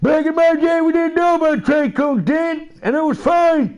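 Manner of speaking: put-on high, creaky old-man whine; hammed-up old geezer complaining; grumpy grandpa. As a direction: play a crotchety grandpa scolding kids. Mock grandpa rant.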